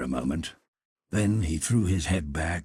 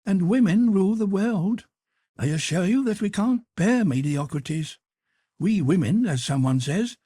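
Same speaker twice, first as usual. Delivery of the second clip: arch, teasing noblewoman, pitched up; high Duchess voice; 8 dB louder than usual. Acted. Duchess challenging Lord Henry, high and teasing. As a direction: play the witty Duchess, high and bright.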